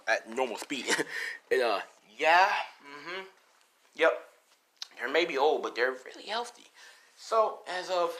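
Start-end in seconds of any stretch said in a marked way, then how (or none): none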